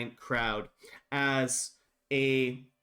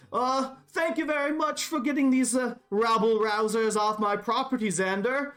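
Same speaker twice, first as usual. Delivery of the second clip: shouting over the crowd; 8 semitones higher than usual. Acted. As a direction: call out grandly to the captain. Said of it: "shout over the crowd".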